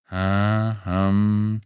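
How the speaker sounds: name stretched syllable by syllable; absent-minded searching mumble-along; searching drawn-out syllables. A narrator's reading. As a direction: sound out a name while leafing through files. Clerk reads along searching.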